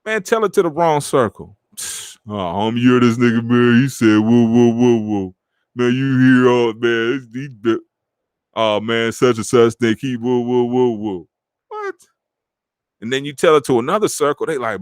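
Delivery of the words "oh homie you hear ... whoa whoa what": mock hype. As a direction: lightly play the friend hyping you up, whoa whoa. Mocking hype of wrong circle.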